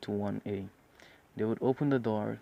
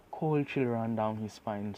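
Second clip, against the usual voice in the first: ordinary delivery, nothing marked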